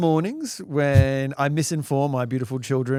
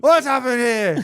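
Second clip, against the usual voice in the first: loud, slow, bewildered yell; confused shout; 8 semitones higher, 7 dB louder than usual. Retold or imitated. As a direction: bellow it out startled and dazed. Acting dazed dad waking.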